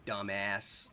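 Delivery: Dumbass with the American 'a' of cat; American accent. Shown as a demonstration. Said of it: "That's how you guys say it".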